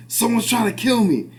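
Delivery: high, panicked mock yell. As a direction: imitate a frantic man. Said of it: "he's like".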